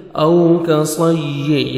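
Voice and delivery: slow, drawn-out melodic tarteel; Quran recitation. Shown as a demonstration. Tajweed teacher reciting a verse for students, melodic and slow. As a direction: chant each syllable, stretching the vowels.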